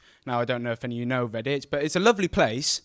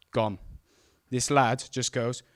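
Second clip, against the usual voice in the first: normal talking voice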